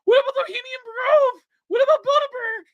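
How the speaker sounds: high shouted repeated demand; retold screaming. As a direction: yell it at him with the pitch way up. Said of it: "screaming at him".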